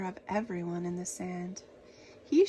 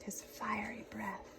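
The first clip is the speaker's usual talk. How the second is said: whisper; 9 dB quieter than usual. A narrator's reading. Narration dropped to whisper.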